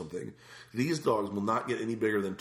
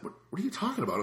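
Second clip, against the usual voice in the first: high, disbelieving what are you talking about; retold exasperation; incredulous; 11 semitones higher, faster than usual. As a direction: rise up in baffled disbelief.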